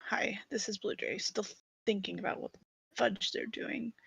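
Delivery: hushed unvoiced aside; whispering. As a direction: whisper it like a secret broadcast. Whispered self-intro.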